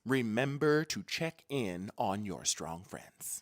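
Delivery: low, slow "Remember to check in on your strong friends"; slow, deliberate reminder. Real, not acted. Host repeats advice to viewers, slow and deliberate.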